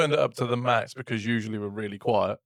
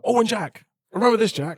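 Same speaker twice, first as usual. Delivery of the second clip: excited reminding; 10 semitones higher, 4 dB louder than usual. Retold or imitated. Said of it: "you're like".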